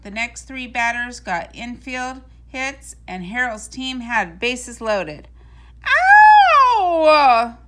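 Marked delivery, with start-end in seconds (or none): 5.8-7.6 sudden high loud yelp of pain; pained cry. A narrator's reading. Big Leon twists his foot stepping in a hole, a loud high yelp. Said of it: "cried a voice".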